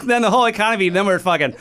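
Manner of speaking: ordinary talk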